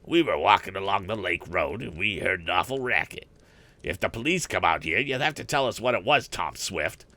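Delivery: cocky bully voice; pushy town bully; bully boy. A narrator's reading.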